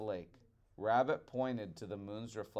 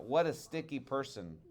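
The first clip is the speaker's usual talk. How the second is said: ordinary delivery, nothing marked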